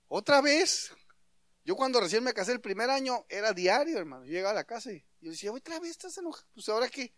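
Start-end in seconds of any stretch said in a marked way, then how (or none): 0.1-0.9 mock exasperation. Husband acting out marital quarrel. 5.5-7.1 high-pitched, hushed complaint; newlywed husband softly whining at his wife's mood; small, high voice of baffled protest; mock exasperation. Husband retelling his reaction to wife.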